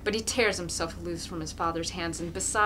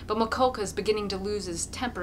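ordinary delivery, nothing marked

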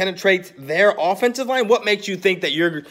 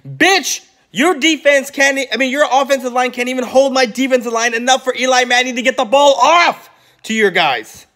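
higher, louder, fast furious rant; angry rant; 8 semitones higher, 4 dB louder than usual. Real, not acted. Vlogger ranting at the rival fan on video, angry and loud. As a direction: let loose on him, loud and heated.

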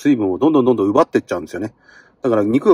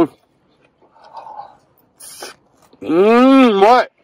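a long, high hum of delight; savoring; 7 semitones higher, much slower than usual. Real, not acted. Savoring another bite, drawn-out and higher. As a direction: melt over the flavor.

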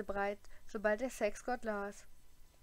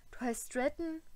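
croaking; 5 semitones higher than usual. A narrator's reading. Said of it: "krächzte er".

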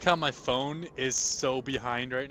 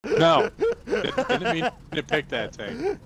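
dismayed; 12 semitones higher, slower than usual. Real, not acted. Dismayed at wrong pick.

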